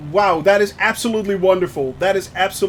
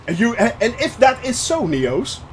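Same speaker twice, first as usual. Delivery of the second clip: usual speaking voice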